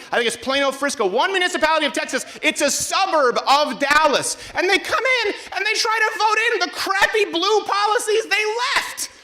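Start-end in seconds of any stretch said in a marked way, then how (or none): 4.5-9.1 much higher, incredulous; railing at Californians, high incredulous voice; exasperated rant. Real, not acted. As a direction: let the disbelief push the pitch up. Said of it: "mocking Californians".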